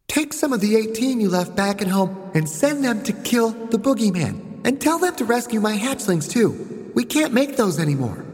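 put-on high little-girl voice; child boss giving orders. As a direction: order the general about like a small commander.

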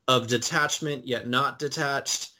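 usual speaking voice

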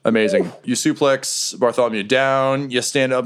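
ordinary talk

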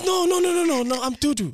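high, loud insistence fading to normal; high denial winding down; insistent Dudu. Retold or imitated. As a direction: protest like Dudu on the phone. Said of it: "She goes".

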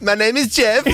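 high-pitched mock Spanish accent; hammed-up impression of a bad Spanish accent; Matty's Spanish accent. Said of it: "this is my impression of you doing that accent".

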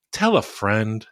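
ordinary talk

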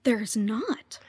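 a soft voiceless whisper of an answer. Real, not acted. Guest admits to the host that the fandom has no name, whispered. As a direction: whisper it like a confession.

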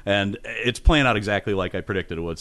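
usual speaking voice

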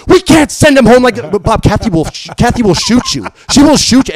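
loud, high-pitched warning; hammed-up, frantic protest; alarmed godmother. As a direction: imitate a wife yelling at her husband. Godmother's alarmed warning in acted argument.

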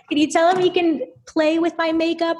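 imitating a guilty, squeaked-up request; guilty peace offering. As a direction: offer it fast and high, to make amends. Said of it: "feeling so guilty".

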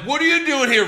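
high, loud baffled referee; a lightly voiced confused ref; mock ref. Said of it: "the ref's like".

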